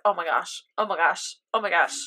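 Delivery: low, soft, repeated worry; quiet retold suspense; hushed dread. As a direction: repeat it under your voice, anxiously. Retold anxious suspense at scene.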